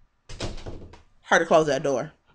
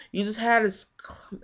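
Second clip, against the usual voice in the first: usual speaking voice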